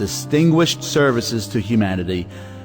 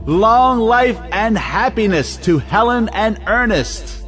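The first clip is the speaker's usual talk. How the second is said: pitched high, ringing family toast; rousing toast; 5 semitones higher than usual. Acted. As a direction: raise a toast to the happy couple. Proposing a toast to the couple.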